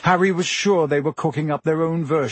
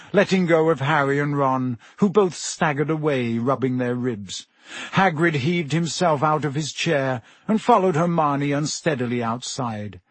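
usual speaking voice

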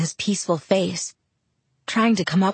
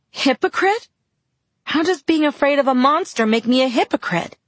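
outraged disbelief; 8 semitones higher than usual. Acted. Bella stung by Jacob's accusation, high outraged disbelief. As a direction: fling the word back in outrage.

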